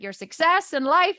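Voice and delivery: acted call-and-response cue; prompting the mantra. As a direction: lead them into the familiar line. Prompting others to recite mantra.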